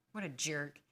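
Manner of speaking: ordinary talk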